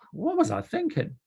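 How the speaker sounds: high-pitched self-questioning; bemused wondering. Acted. Looking at things written in the past, wondering in high-pitched disbelief. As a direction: pitch leaps up in puzzled disbelief. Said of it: "and wondered".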